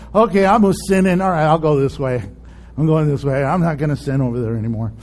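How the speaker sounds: low, unenthusiastic promise; half-hearted repenting. Acted. Sinner repenting without zeal, half-hearted and low. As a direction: mumble through a grudging promise, pitched well down.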